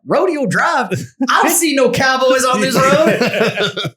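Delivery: high, slow, laughing quote; movie quote. Retold or imitated. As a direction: quote the Mighty Ducks kid. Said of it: "and he's like".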